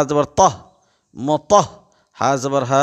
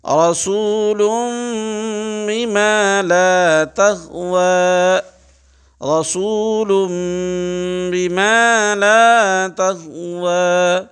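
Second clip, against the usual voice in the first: slow, drawn-out tajweed chant, raised pitch; Quranic recitation; 5 semitones higher, much slower than usual. Shown as a demonstration. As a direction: intone each syllable like a qari. Recites verse three times. (تجوید کے ساتھ آہستہ تلاوت)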